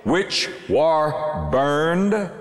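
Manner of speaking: biting, withering mockery in slow, drawn-out questions; dripping with contempt, dragging out the words; scornful sarcasm. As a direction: sneer each slow question as a taunt. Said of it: "Can you hear the sarcasm?".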